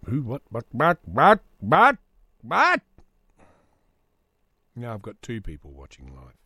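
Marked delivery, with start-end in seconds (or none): 0.1-2.8 from confused mumble to squeaky disbelief; building baffled what. Real, not acted. Puzzled outburst, rising.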